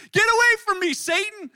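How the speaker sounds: high, full-volume shout; loud rebuke. Acted. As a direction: bellow the rebuke so everyone nearby hears. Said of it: "And Jesus loudly says".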